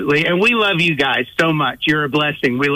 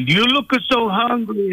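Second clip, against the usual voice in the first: high-pitched imitation of an Italian grandma; Italian grandmother; 6 semitones higher than usual. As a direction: mimic a fussing nonna, pitched well up. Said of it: "I remember my grandmother, she was like that".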